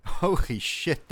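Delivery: laughing, stunned holy shit; excited laugh. Real, not acted. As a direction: burst out laughing in disbelief. Plane crashes onto German position.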